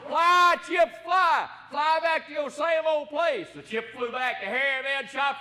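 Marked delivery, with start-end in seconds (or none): 0.1-3.5 high, loud hollered command; hollering. Acted. Boy up the tree hollers at the wood chips, loud and high. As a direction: holler it up the tree with all you've got. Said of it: "he hollered".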